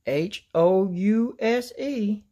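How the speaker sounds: slow spelling. A narrator's reading. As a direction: lead kids spelling slowly. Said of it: "says Princess Presto".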